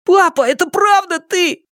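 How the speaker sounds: put-on high-pitched little boy's cry; excited cry. A narrator's reading. Little son crying out to his returned father, high excited voice. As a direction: cry out high, hardly believing it. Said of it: "вскричал Сыма Лян".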